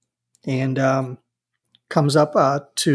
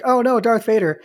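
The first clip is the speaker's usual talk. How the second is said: panicked Wicket; 7 semitones higher than usual. Retold or imitated. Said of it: "Lork freaks out and he's like".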